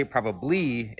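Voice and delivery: "probably" drawn out with weight on its final syllable; marked intonation on "probably". Shown as a demonstration. Linguist explains what the hedge means, marked intonation on "probably". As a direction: say "probably" as a hedge, ending on a stressed "-LY". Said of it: "it means something like".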